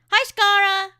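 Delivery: loud, high bright hello; cheerful greeting. Real, not acted. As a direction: call out a happy hello.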